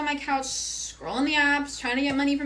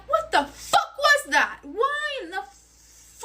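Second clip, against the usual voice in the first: angry confrontation; 12 semitones higher, much slower than usual. Retold or imitated. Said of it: "And I'm like".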